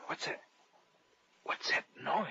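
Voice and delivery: urgent hushed question; frightened whisper; tense whisper. Acted. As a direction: whisper it while hiding. Hiding from the Baron.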